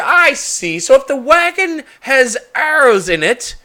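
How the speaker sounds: high, loud mocking reasoning; mock their logic slowly; sarcastic challenge. Shopkeeper mocking the adventurers' excuse.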